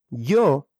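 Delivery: normal talking voice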